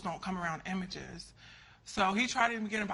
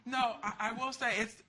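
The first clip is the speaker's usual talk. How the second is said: usual speaking voice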